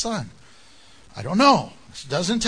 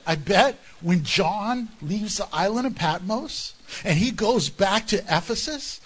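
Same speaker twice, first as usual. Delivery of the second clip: ordinary talk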